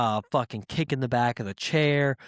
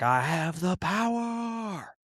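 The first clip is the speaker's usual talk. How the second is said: slow, higher, booming hero proclamation; He-Man hero cry; 5 semitones higher, slower than usual. Retold or imitated. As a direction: bellow it like He-Man raising the sword. He-Man pose with sword.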